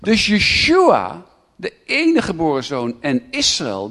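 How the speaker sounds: usual speaking voice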